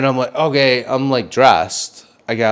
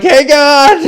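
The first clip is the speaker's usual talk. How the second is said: very high, loud yelp; startled shout; 9 dB louder than usual. Retold or imitated. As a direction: shout out in mock alarm. Startled shout at intruders in apartment.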